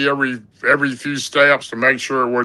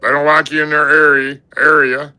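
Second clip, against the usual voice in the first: granddad; 3 semitones higher, 3 dB louder than usual. Retold or imitated. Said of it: "he goes".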